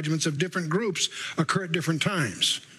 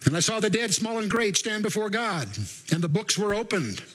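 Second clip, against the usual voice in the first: higher, measured verse recital; scripture reading; 6 semitones higher than usual. Acted. Preacher reads of the dead before God, scripture reading. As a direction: proclaim each phrase from the page.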